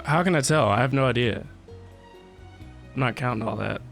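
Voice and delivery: ordinary talk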